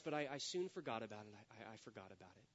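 low, hushed, trailing-off aside; quiet confession. Acted. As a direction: quote it under your breath, sinking away. Quoted confession, dropped to an undertone.

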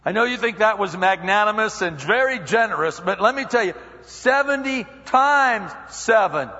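emphatic teacher-like Jesus; Jesus correcting Peter. Retold or imitated. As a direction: gently overrule a proud student. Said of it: "He says".

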